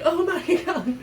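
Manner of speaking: high laughing oh my God; laughing exclamation. Retold or imitated. Friend reacting to snake bite, laughing. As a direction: burst out laughing in disbelief.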